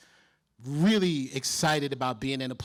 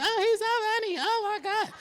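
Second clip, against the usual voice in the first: high squealing fangirl gasp; mock swooning girl in the audience; swooning groupie. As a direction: squeal it like a starstruck fan.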